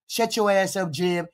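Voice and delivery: ordinary talk, nothing marked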